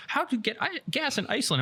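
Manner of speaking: high-pitched mock girlfriend voice; put-on high voice for a baffled question; imitating girlfriend. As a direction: pitch it way up to mimic your girlfriend. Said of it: "my girlfriend's like".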